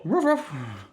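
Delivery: lightly imitated dog bark; mock dog bark.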